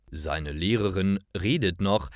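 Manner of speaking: usual speaking voice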